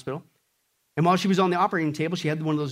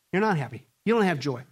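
normal talking voice

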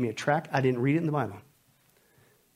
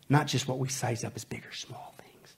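hushed whisper; whispered emphasis in a sermon; 3 semitones lower, 8 dB quieter than usual. Real, not acted. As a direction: drop to a whisper to drive it home. Whispered repeat of the point.

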